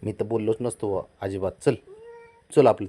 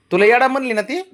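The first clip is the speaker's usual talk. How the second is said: high excited calling; 13 semitones higher, 9 dB louder than usual. Real, not acted. Father calls out to the baby.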